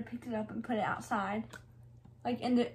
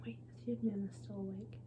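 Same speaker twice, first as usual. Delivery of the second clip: whispering; 3 semitones lower, 15 dB quieter than usual. Real, not acted. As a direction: whisper so Nana won't wake. Sneaking near sleeping Nana.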